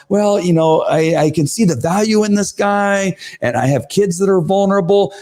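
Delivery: lightly voiced hesitant board member; mock hesitant. Parent mimicking the undecided member's excuse, light hedging. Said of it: "she began to, like".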